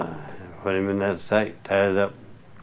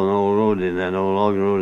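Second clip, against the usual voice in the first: ordinary talk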